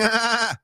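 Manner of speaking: high bleating goat imitation; goat sound. Shown as a demonstration. Host performing for his co-host, goat bleat. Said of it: "do the goat sound".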